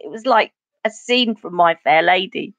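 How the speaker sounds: ordinary talk, nothing marked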